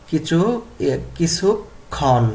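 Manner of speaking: কিছুক্ষণ broken up slowly, with a long final khon; slow pronunciation model. Shown as a demonstration. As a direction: sound out কিছুক্ষণ piece by piece. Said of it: "এইভাবে উচ্চারণ করতে হবে".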